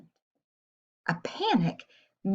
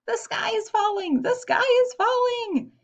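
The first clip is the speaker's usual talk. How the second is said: mock scream, pitched up high; panicked screaming; 14 semitones higher than usual. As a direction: shriek it while running in fright. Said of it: "screamed as they ran".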